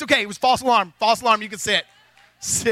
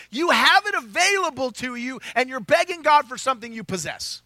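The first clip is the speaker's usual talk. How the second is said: ordinary delivery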